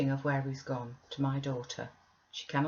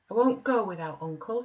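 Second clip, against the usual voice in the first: put-on high, louder protest of a sulky boy; stubborn child's cry; 5 semitones higher, 5 dB louder than usual. A narrator's reading. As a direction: dig in your heels like a spoilt child. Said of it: "he cried at length".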